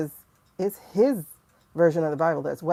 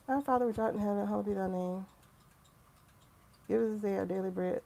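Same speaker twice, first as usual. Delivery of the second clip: murmured, memorized prayer; rote prayer; 3 semitones higher, 8 dB quieter, faster than usual. Shown as a demonstration. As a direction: softly recite the Lord's Prayer by rote. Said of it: "I say it every night".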